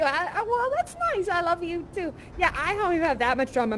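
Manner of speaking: ordinary talk, nothing marked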